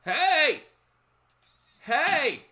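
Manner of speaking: imitated high, loud holler; sharp high-pitched mock yell; old lady calling out. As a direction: shout for attention like an old woman. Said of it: "She's like".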